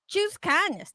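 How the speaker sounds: ordinary talk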